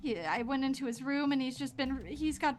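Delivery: a mother holding it together, scared; anxious mother. Acted.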